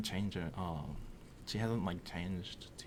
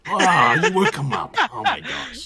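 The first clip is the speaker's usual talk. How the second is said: high, very loud outburst; loud exclamation; 10 semitones higher, 14 dB louder than usual. Pretend. Players blame someone for waking the sleeping prince, loud exclamation. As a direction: cry out in mock dismay.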